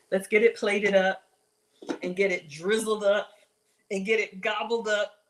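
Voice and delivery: normal talking voice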